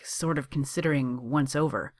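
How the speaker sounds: usual speaking voice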